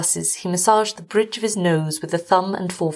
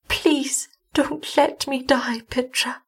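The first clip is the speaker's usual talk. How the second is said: feeble pleading; 6 semitones higher, slower than usual. A narrator's reading. Dying wife begging her husband, feeble and slow. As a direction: beg weakly, trailing down in volume.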